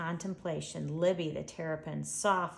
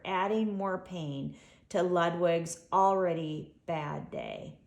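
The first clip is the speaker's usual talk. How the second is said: ordinary delivery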